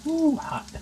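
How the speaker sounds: high yelp at the heat; startled. Real, not acted. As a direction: pull back from the heat. Touching hot chicken.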